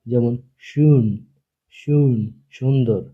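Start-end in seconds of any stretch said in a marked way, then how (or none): 0.7-2.1 "শুন" with rounded lips, between u and o; German ü demo. Shown as a demonstration. Teacher shows students how ü sounds in "শুন". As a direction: say "শুন" slowly with the vowel between উ and ও. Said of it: "উচ্চারণ হবে ও এর মাঝামাঝি".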